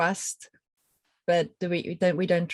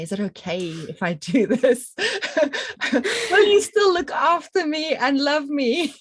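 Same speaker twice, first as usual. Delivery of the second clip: high, loud mock plea through laughter; pleading partner; 8 semitones higher, 6 dB louder than usual. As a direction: playfully beg for permission, breaking into laughs.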